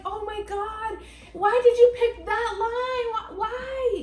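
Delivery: high, drawn-out horrified mother; mother horrified by her son's movie line; exasperated disbelief. Retold or imitated. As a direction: plead in disbelief, stretching it out. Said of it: "and I'm like".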